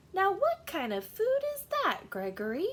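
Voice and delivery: Mother Goat aghast at his tastes; shocked mother. Acted. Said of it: "she said".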